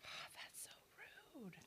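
whispered under her breath; hushed so the stream won't hear; annoyed whisper. Real, not acted. To the crew off-mic, a whispered complaint.

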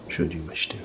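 ordinary delivery, nothing marked

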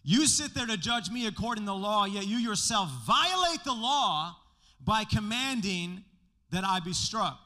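loud slowed outrage; indignant Paul. Retold or imitated. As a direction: throw the charge back at the priest. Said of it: "he says".

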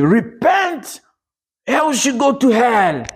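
high, ringing warning cry; street preaching. Retold or imitated. Preacher at the microphone warning people on the street, urgent preaching. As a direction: call it out to passers-by like a street evangelist. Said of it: "I took the microphone and started preaching".

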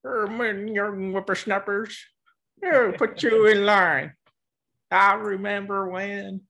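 put-on high, slow, creaky old man; a doddering grandpa scolding kids; very old faint voice. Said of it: "time for me to pretend to be old".

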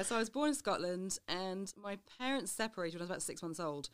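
usual speaking voice

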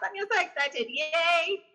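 high, bright mock cheer; a high mock yay for the fan; mock excited yay. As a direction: squeal it like a thrilled fan. Mimics excited fan reaction.

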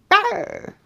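tiny high-pitched roar; squeaky mock roar; Baby Bear growl. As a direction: growl like a little cub.

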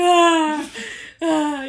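loud tearful ah between laughs; half-laughing sob; retold crying mixed with laughter; crying wail. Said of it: "lloraba todo el rato".

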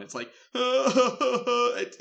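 high, loud mock sobbing; imitated distressed crying. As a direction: fake a loud, gasping wail. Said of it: "it's super distressed crying".

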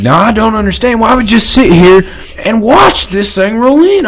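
high, mocking voice of a baffled guy; mocking quote. Mocking a man who asks why they just sit there, high mock voice. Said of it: "He said, he said".

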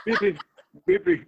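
put-on high, loud cooing to a baby; Mads Mikkelsen. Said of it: "Mads Mikkelsen talking to a baby".

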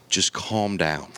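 calming dad. Retold or imitated. Dad calming his son with a hand on his shoulder, slow soothing. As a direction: settle him down with a hand on the shoulder. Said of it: "he goes".

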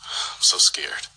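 slow, frightened whisper; scared whisper. Acted. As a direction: breathe it out slowly, afraid. Host frightened by rogue message.

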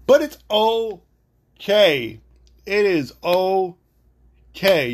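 drawn-out "okay", syllable by syllable; slow, emphatic reassurance. Real, not acted. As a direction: stretch out "okay" to settle it. Calming self down after rant.

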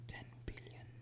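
whispered ten billion. Real, not acted. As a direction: hush it right down. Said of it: "Why are you whispering?".

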